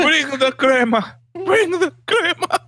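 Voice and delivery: very high cartoon Mexican accent; squeaky put-on Mexican accent; mock Mexican accent. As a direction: call it out as a hammed-up Mexican dad. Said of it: "adding so much of an accent".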